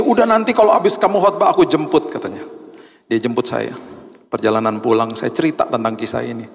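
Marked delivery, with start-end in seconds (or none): 0.0-2.1 guilty wife. Retold or imitated. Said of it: "Istri saya feel guilty".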